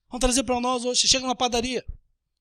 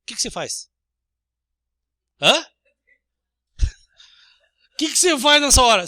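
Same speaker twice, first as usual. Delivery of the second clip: ordinary talk, nothing marked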